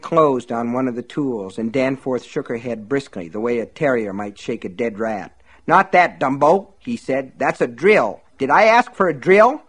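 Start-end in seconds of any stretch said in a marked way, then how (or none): 5.7-9.6 loud sneering rebuke; contemptuous scolding. Acted. Buster scolds Myrtle for grabbing the drill, loud contempt. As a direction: belittle her, loud and mean.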